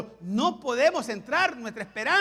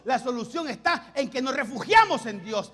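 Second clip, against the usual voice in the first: louder, higher pulpit delivery; fiery preaching; 4 semitones higher, 6 dB louder than usual. Acted. Pastor urging his church to trust God, fervent preaching. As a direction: drive the point home with fervor.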